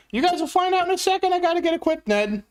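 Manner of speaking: very high, fast squeaky character voice; squeaky cartoon voice. Teasing viewer Ned with a squeaky cartoon voice. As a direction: pipe it up like a silly little cartoon figure.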